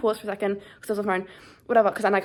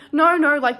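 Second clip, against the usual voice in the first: higher, louder retold refusal; urgent protest; 6 semitones higher, 6 dB louder than usual. Woman refusing help as stranger grabs suitcase, urgent protest. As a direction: protest quickly rising above your usual voice.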